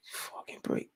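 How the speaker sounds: whispered curse under the breath. Real, not acted. Fan cursing to himself about the break, whispered. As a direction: hiss it out without voice. Frustrated aside during match.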